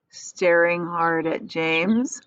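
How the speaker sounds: plain reading voice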